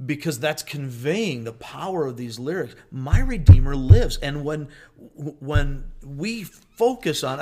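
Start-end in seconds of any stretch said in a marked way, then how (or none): 2.9-7.4 pounding the desk while preaching; thumping the table; banging the desk. Real, not acted. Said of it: "I should stop hitting the desk".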